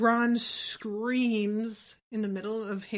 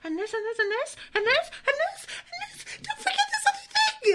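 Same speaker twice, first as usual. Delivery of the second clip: squeaky, very high nagging chant; mock anxious voice in the head; panicked inner voice; faster than usual. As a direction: climb higher and higher, piling on reminders. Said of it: "this voice in my head going".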